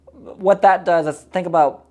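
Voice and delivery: ordinary talk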